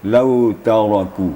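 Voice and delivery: "lau taraku" slow, heavy ra; careful tajwid reading; slow recitation. Shown as a demonstration. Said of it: "ini juga harus hati-hati".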